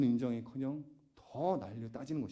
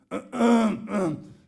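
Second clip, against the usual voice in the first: loud, exaggerated throat clearing; repeated signalling ahem; pointed throat-clearing; 6 semitones higher, 8 dB louder than usual. Acting out signalling the kids.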